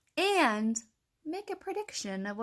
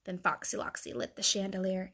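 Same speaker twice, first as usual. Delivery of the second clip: ordinary delivery, nothing marked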